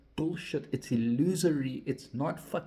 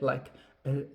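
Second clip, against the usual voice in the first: normal talking voice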